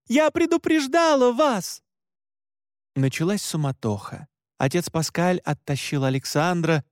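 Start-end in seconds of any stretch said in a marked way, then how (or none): 0.1-1.8 very high, insistent wail. A narrator's reading. Marie reproaching the others, wailing high. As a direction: shriek the reproach at a high pitch. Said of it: "тут же завопила Мари".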